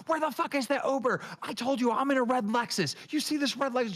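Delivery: high, shrill-pitched mock freakout. Host mimicking Roxy yelling about the Uber, mock freakout. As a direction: play her losing her mind. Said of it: "She's losing her fucking mind".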